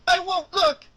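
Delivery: put-on high, frightened child refusal; scared stubborn boy.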